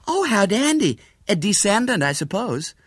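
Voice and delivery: high, delighted American lady; excitable American lady tourist. A narrator's reading. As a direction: exclaim with delight, then settle a little.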